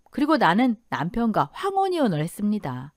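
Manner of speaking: usual speaking voice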